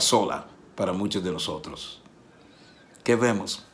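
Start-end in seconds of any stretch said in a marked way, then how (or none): none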